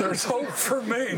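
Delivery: relieved laughter, drawn out; slow laughing repeat. Retold or imitated. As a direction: savour it with a laugh. Joy at hearing the preacher.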